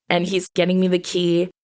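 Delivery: normal talking voice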